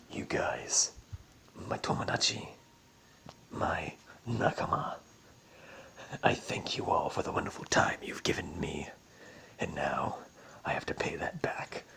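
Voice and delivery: very low, hushed and husky anime hero; deep manly voice. Retold or imitated. Antonora thanking her comrades, mock-solemn deep manly voice. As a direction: drop it deep for a solemn, breathy farewell. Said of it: "this deep-ass manly voice for no reason".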